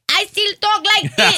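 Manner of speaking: high, loud mock Colombian accent; Sofia Vergara. Mocking a TV actress to friends, exaggerated Colombian accent. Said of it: "you gonna tell me that she".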